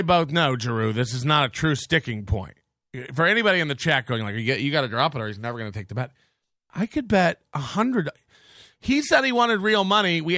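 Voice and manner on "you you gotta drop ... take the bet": low, fast mock voice; mocking imitation. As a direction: parody the chat, lower and quicker. Mocking chat commenters.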